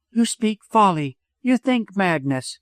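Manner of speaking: high-pitched, reproving woman; reproving widow. A narrator's reading. Widow shakes her head at doctor, reproving.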